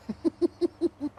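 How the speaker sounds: high, hooting monkey laugh; mayor monkey cackling at the flag; monkey laughter. Acted.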